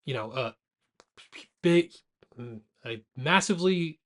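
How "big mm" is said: usual speaking voice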